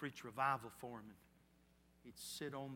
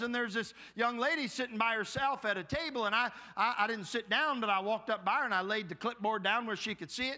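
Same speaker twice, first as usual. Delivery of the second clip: preacher building steam, louder and higher; fired-up revival storytelling; 8 semitones higher, 8 dB louder than usual. Acted. Preacher telling the congregation his campus story, louder and higher. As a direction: tell it like the congregation's on the edge of their seats.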